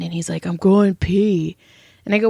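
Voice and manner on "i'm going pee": slow, drawn-out imitation of a drunk man; drunk husband. Wife imitating drunk husband answering her in the bathroom, slow and oblivious. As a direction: stretch it out like a tipsy reply. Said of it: "I'm drunk too".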